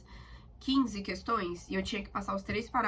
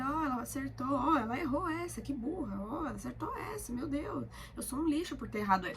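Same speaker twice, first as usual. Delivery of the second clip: higher, gossipy voice pointing out her errors; mocking viewers; 4 semitones higher, 3 dB quieter than usual. Nosy viewers comment on her exam answers, higher gossipy tone. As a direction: pretend to be nosy viewers judging her test. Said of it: "vocês vão acabar vendo".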